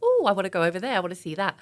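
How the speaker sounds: gushing over something shiny; excited. Retold or imitated. Acting out magpie self excited.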